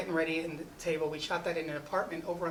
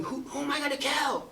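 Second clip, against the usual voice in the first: much higher yelled question; yelling; 10 semitones higher than usual. Retold or imitated. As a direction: yell it like an actor losing it. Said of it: "and Channing's yelling".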